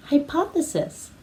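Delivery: Hypothesis said syllable by syllable, hai-PAH-thuh-sis; careful model word. Shown as a demonstration. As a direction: spell out Hypothesis for kids to echo. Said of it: "Can you say hypothesis?".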